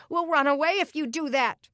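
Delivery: put-on a boy threatening to run away; loud, high defiant child; defiant kid. Brother's emotions take over.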